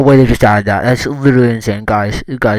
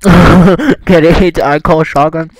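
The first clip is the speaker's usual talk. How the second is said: laughing; 5 semitones higher, 4 dB louder, much slower than usual. Real, not acted. Laughing at own pun.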